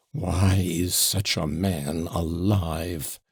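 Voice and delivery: slow, hollow, raging growl. Acted. Son raging at his father, slow hollow growl. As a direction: growl it out, beside yourself. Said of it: "growled in a hollow voice".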